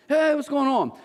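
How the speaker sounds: high-pitched casual hello; imitated cheery greeting. Imitating young neighbour walking into the house, high casual greeting. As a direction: pitch it up like a visitor strolling in.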